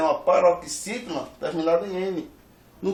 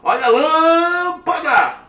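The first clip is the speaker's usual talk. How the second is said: high, loud sports commentator cry, first syllable stretched; Galvão Bueno; 9 semitones higher, 8 dB louder, slower than usual. Acted. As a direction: belt it out like a goal call. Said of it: "Imagine que o Galvão Bueno vai falar". (narrador esportivo, sílaba tônica esticada)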